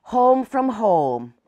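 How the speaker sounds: plain reading voice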